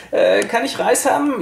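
high, whiny mock beggar; begging voice. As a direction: play the wheedling freeloader. Schnorrerin bettelt im Laden. (hoch gezogene Bettelstimme)

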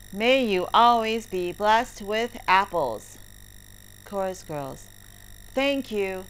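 usual speaking voice

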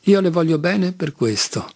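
usual speaking voice

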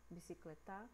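bicicletar stressed on final TA; wrongly stressed bicicletar, bi-ci-cle-TÁ; stress on last syllable. Shown as a demonstration. Teacher repeating a wrong version, bicicletar stressed at the end. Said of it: "A gente não fala".

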